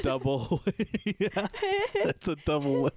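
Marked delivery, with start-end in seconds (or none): 1.0-1.5 high laugh. Real, not acted. Laughing at the joke.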